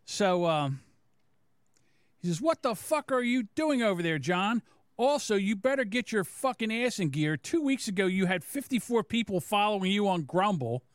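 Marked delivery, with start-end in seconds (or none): 2.4-10.8 higher-pitched mocking read; put-on scolding voice, higher; Eric. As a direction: imitate his scolding, pitched up. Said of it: "let me get my Eric voice on".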